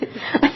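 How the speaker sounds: quick high giggle; real laugh. Real, not acted. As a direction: giggle at how silly it was. Laughs at own tearful story.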